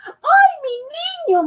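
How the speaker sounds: high wailing cry of alarm; a mother's frightened wail; mother crying out for her son; cried out. Acted. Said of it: "Mama cried".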